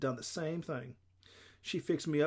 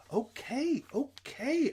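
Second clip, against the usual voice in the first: put-on high, drawn-out child's okay; impatient agreement; 11 semitones higher, much slower than usual. A narrator's reading. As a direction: agree just to make it stop. Child cuts off lecture by agreeing.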